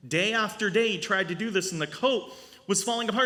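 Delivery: ordinary talk, nothing marked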